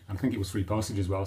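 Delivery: normal talking voice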